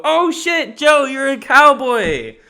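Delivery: high, loud mock shock, last words slow; mock surprise. Reacting to Joe's cowboy in the improv skit, high mock shock. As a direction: squeal it out as if startled by a cowboy. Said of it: "And I'll go".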